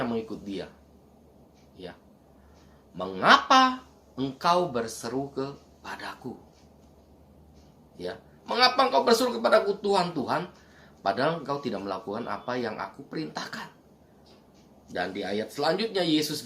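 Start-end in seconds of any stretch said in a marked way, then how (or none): 3.0-6.3 slow, deliberate question with weight on "Mengapa"; measured, slow reading of the question; slow quote. Shown as a demonstration. Jesus's question slowly repeated. 8.5-13.7 urgent preacher firing the question; pressing quote. Shown as a demonstration. The same question, now pressed.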